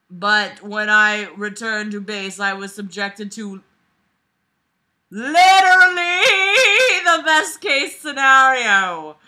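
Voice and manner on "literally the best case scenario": loud, high, drawn out excitement; excited. Real, not acted. Reading the good news, loud excited yell. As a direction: yell it out thrilled.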